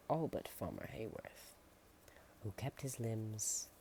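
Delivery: plain reading voice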